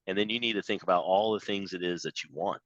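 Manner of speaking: normal talking voice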